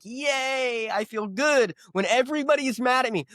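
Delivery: a gleeful, half-joking yay at being hated; mock cheer. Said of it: "That's when I'm like".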